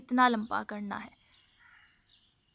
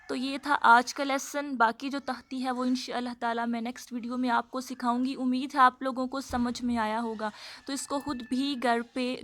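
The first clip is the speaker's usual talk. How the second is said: normal talking voice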